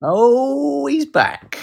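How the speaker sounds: high, drawn-out mock fanfare; high-pitched hammed-up greeting; playful welcome back. Greets returning co-host theatrically.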